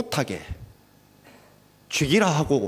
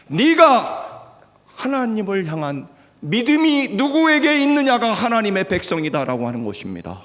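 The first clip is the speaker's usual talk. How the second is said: impassioned climax; 10 semitones higher, 5 dB louder than usual. Real, not acted. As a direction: lift the whole church with it. Climactic sermon line.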